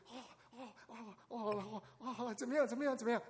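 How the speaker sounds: 模仿家长哄哭闹婴儿，先轻后高; 学妈妈抱着孩子哄，哦哦哦; 哄孩子的家长. Retold or imitated. Imitating a parent soothing baby. (学父母哄婴儿的腔调)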